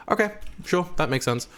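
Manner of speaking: high-pitched, agreeable okay to the teacher; compliant agreement. Retold or imitated. Student going along with the screen teacher's no-accent request, compliant. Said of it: "And I was like".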